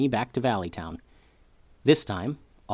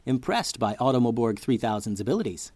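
ordinary delivery, nothing marked

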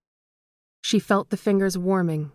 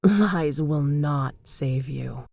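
menacing phantom; 3 semitones lower, much slower than usual. A narrator's reading. Phantom threatening its victim.

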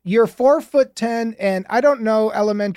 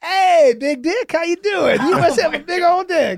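high, booming crude hello; hammed-up locker-room greeting, pitched high; hearty mock greeting; 7 semitones higher than usual. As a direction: greet him with over-the-top bravado. Acts out the crude greeting he planned.

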